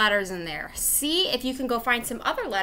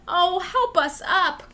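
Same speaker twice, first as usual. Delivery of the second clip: mild cry for help; 8 semitones higher, 5 dB louder, slower than usual. Acted. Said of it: "cried A".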